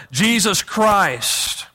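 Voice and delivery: ordinary delivery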